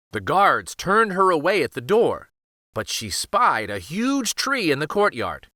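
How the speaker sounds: usual speaking voice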